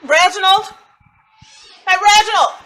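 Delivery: high, loud call into the house. Acted. Mother calls to Reginald inside, loud and high. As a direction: call him from the porch.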